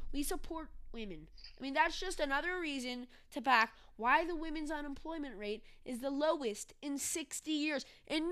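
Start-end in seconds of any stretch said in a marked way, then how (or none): none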